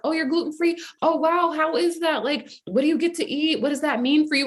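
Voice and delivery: high-pitched, curious stranger imitation; acted fascination, voice lifted high; genuine interest. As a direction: ask with wide-open, eager curiosity. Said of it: "just genuine concern and interest".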